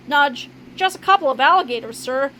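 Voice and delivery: ordinary delivery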